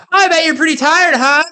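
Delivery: high, loud sing-song taunt at passing cyclists; mocking taunt. Taunting cyclists from a golf cart in a high, loud mocking voice. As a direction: rub it in, mock them from the cart. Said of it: "just to mock people".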